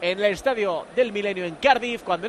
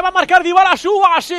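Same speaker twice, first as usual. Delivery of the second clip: rising shouted call of the save; excited shout; 7 semitones higher, 7 dB louder than usual. Real, not acted. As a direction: yell the chance as it happens. Commentator calls near goal.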